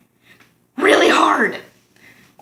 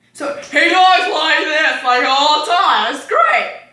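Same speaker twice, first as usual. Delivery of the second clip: imitating Red's loud talk, a bit louder; loud voice demo; 5 semitones higher than usual. Shown as a demonstration. Mimicking friend who can't whisper, loud. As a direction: show how loud he talks. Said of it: "Red doesn't know how to whisper".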